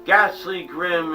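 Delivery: ordinary delivery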